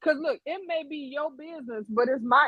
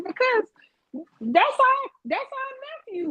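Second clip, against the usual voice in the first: mock exasperated; 11 semitones higher, 4 dB louder, much slower than usual. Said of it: "And I be like".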